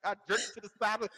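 ordinary talk

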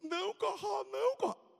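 high, squeaky begging; mock-panicked begging; pleading. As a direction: plead like a terrified kid. Bully begs to be spared.

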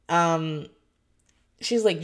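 normal talking voice

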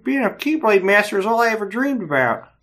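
high, bright cartoon dad impression; mock Family Guy dad reading a hero line; Peter Griffin voice. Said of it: "Hey, Lois".

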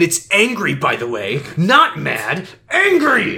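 indignant, pedantic correction rising to a high "Angry!"; mock-angry, climbing sharply at the end; Ricky impression. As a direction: act out an offended boyfriend, punching the key words. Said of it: "that was a pretty good at Ricky impression".

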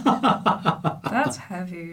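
slow, laughing it out. Real, not acted. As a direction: stretch it out through laughter. Laughing repeat.

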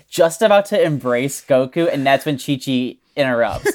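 ordinary talk, nothing marked

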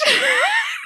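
high shrieking laugh. Real, not acted. Laughing shout after mistake.

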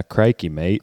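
lightly attempted Australian accent. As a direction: try on a casual Aussie accent. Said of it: "What about Australian?".

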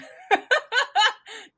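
high, loud burst of laughter; real laugh at your own joke. Real, not acted.

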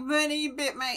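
high mock whine of a sore loser; whining. Schoolyard bully complaining about being bitten, whining. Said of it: "like, whining, like".

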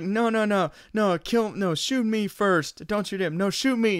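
high, eager mock pleading; pleading to be shot first. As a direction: beg to take the bullet, hammed up. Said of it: "They're just like".

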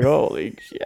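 a startled "holy shit" in alarm; shocked outburst. Retold or imitated. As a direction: blurt it out in fright. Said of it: "We're like".

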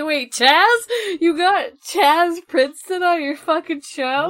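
loud, high disbelieving shriek; squealing with surprise and delight; excited shout. Real, not acted. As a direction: yell it in thrilled disbelief. Excited disbelief at guest news.

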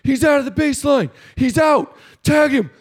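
high shouting from the dugout; mock coach shouting. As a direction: yell it at the field like a rival coach. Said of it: "starts saying other things like".

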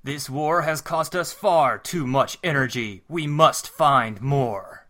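slow, weighty heroic leader; grave commander, very slow; Optimus Prime voice. Acted. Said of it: "I'll be Optimus".

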